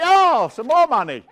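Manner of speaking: high, loud, hammed-up glee; mock gambler's delight. Acts out delighted gambler at tables.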